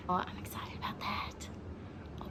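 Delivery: hushed, breathy thrill over the cake; excited whisper. Real, not acted. Telling viewers about her dessert, in an excited whisper. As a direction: whisper it like a treat you can't wait for.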